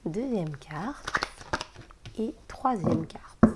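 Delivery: slow hushed whisper, each card counted out; whispered card draw. Real, not acted. Counting out tarot cards for viewers, slow whisper. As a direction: breathe the card names out slowly.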